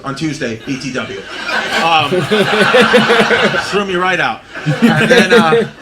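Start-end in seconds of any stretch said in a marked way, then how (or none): 2.1-3.6 loud laugh. Real, not acted. Laughs at own story.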